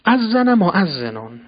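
slow, loud, ringing call of اذن مؤذنون; loud call to prayer. Shown as a demonstration. Preacher showing how a crier calls out, slow and loud. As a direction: proclaim اذن مؤذنون like a muezzin waking the town. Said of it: "اذانی که مردم را از خواب بیدار کند".

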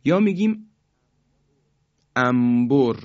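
ordinary talk, nothing marked

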